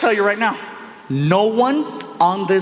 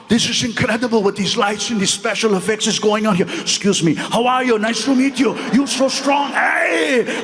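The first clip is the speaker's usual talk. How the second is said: put-on excitable Austrian-accented star, climbing higher as he greets; Arnold Schwarzenegger; 3 semitones higher than usual. Star working through his fans, gushing impression. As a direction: gush in a thick action-star accent, rising to a shout. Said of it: "I'm watching Arnold Schwarzenegger light people up".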